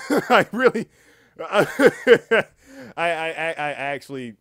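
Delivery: bursting into loud laughter; words breaking up in high laughter; laughing. Real, not acted. Cracks up at what they did.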